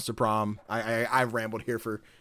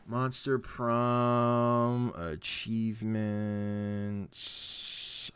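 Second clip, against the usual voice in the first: very slow, softer drawn-out words; absent-minded reading; 3 semitones lower, 7 dB quieter, much slower than usual. Real, not acted. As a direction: stretch each word while searching. Mumbling while looking something up.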